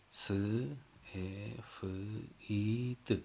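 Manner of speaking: سفید split into separate sounds س، ا، ف، ای، د; sounding out letters. Shown as a demonstration. Teacher showing how children sound out سفید.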